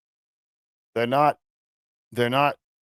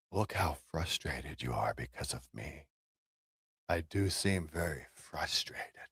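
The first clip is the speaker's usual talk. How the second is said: 1 whisper; 7 semitones lower, 13 dB quieter than usual. Acted. Mock whisper taunt.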